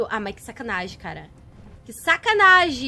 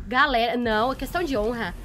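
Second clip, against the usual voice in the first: usual speaking voice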